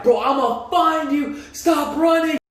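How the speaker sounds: high, drawn-out mock shout; retold screaming. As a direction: imitate a gunman yelling mid-chase. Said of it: "he's screaming as he's like".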